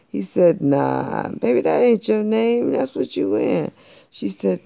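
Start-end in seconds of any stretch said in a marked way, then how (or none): 0.6-3.7 louder, higher smooth cat; cool cat. A narrator's reading. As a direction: slide it over smooth and teasing. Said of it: "cool cat".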